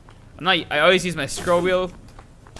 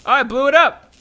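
excited; 10 semitones higher, 7 dB louder than usual. Real, not acted. Gamer blowing up object.

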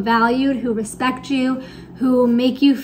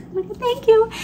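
high, bright mock-gushing reply; gushing thanks; 10 semitones higher than usual. Retelling thanking the TA who praised her, mock-gushing. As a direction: replay your own flustered delighted thanks.

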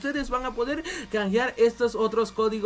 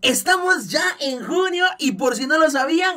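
kindergarten teacher voice; 5 semitones higher, 4 dB louder than usual. Acted. Addresses kids as chiquitines.

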